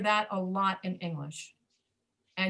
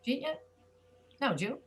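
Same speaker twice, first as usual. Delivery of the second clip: fast American speech; 4 semitones higher than usual. Retold or imitated. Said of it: "Americans who speak fast, they will say".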